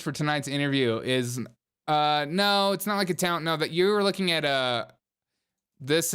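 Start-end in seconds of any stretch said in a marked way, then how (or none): none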